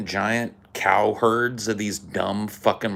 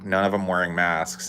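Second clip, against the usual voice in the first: usual speaking voice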